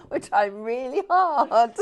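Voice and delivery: slow, loud, high and giggly; laughing protest. Real, not acted. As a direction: plead jokingly through laughter. Joking about failed attempt, laughing.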